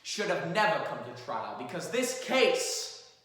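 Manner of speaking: measured, earnest closing argument; courtroom lawyer. Acted. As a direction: address the jury with gravity.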